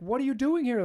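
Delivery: high, loud alarmed demand; husband startled by his mistress; shocked outburst. Retold or imitated. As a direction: blurt it out in panic.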